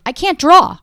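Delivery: loud, high cry of defeat; loud exasperated admission; exasperated blurt. Real, not acted. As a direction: burst out with it. Blurting out a confession.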